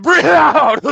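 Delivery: loud high hammed-up exhale line; mock guru. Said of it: "she's like".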